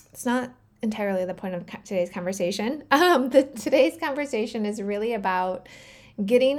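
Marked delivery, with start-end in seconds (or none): none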